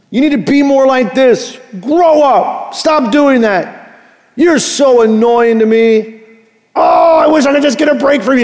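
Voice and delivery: harsh scolding parent. Retold or imitated. Pastor imitates an exasperated parent, building to a fast shout. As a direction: nag a child, then burst out in frustration. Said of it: "hear nothing but harsh correction".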